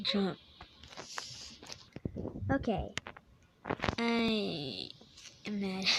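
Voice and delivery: usual speaking voice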